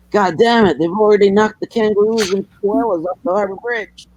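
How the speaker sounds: high-pitched, indignant mock Texan; cartoon Texan. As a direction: rant like an outraged cartoon Texan. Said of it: "He'd probably be going like".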